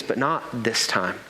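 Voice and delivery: ordinary talk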